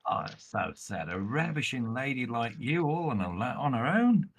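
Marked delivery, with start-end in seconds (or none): none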